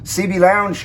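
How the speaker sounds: ordinary talk, nothing marked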